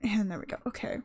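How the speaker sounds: ordinary delivery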